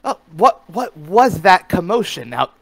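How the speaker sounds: loud, high-pitched startled questioning; agitated in-character outburst; piping up. Acted. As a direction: demand to know about the commotion. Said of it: "pipes up and says".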